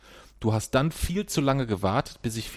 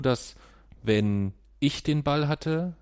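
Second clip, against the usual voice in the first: ordinary delivery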